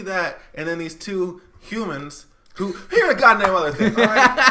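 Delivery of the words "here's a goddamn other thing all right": rising, louder exasperated rant. Real, not acted. Ranting to a friend about the show, louder and exasperated. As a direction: build up the outrage at the silly show.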